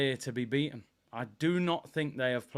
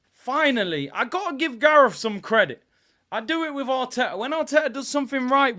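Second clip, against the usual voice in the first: loud and higher, fast; excited rant; 10 semitones higher, 8 dB louder than usual. Real, not acted. Fan praising the manager to viewers, excited rant. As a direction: rave about it.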